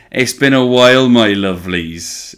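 loud, high hammed-up Cornish greeting; Cornish accent. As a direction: greet the listeners like a Cornish local. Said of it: "reason I'm talking like this".

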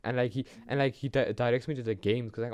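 usual speaking voice

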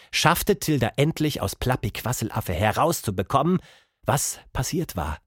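ordinary delivery, nothing marked

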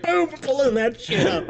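high, slow gleeful gloat; gleeful boast. Retold or imitated. Streamer gloating to friends about pulling up the cosplay clip, high and slow. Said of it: "I'm like".

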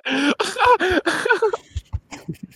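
high-pitched, drawn-out laugh; laughing fit. Real, not acted. Podcast host cracking up with friends, high laughing fit.

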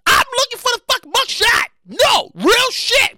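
high, loud disbelieving rant; incredulous outburst. Real, not acted. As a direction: demand answers in shrill disbelief. Outraged ranting about missing perp walk.

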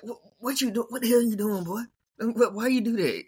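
put-on high scolding what you doing boy; mock scolding agent. Agent scolding his player after the fight, high mock scolding. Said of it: "I'd be like".

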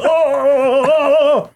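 high, wavering mock yells; imitated electric-shock cries. Retelling a man getting electrocuted, imitated cries of pain. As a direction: mimic someone being electrocuted. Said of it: "And he was going".

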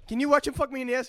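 fast, high, loud French Canadian whine; xQc French Canadian accent. Retold or imitated. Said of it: "his stupid French Canadian accent".

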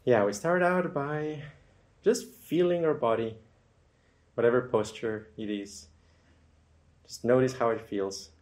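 unhurried meditation guide with long, settling pauses; guided meditation. Acted. Guide leads listeners into feeling comfortable and relaxing, guided meditation. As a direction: ease listeners gently into noticing the body.

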